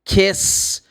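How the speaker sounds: kiss said short with a crisp s. Shown as a demonstration. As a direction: model kiss clearly. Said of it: "if I say kiss".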